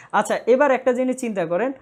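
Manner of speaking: usual speaking voice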